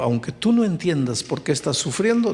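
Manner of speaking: ordinary delivery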